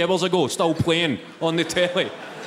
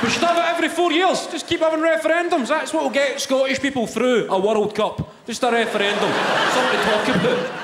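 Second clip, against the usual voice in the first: excited rant; 6 semitones higher than usual. Real, not acted. Comedian pitches endless referendums to the crowd, excited. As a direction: push it up with glee.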